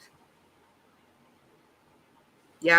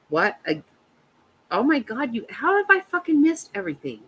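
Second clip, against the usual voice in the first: gasp, then rising high in disbelief; can't believe what she missed; shocked gasp; 7 semitones higher than usual. Real, not acted. Reading the chat and shocked she missed everything.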